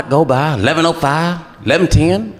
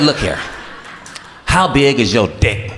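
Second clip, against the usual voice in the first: low, slow, seductive voice; sleazy producer; 6 semitones lower than usual. Retold or imitated.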